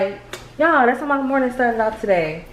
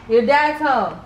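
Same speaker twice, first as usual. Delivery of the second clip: calling out; 4 semitones higher, slower than usual. Real, not acted. Calling out to child in house.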